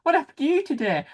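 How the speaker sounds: high-pitched acted-out greeting at home; playful questioning. Host imagining the woman's family at home, playful questioning. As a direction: imitate an eager relative asking for news.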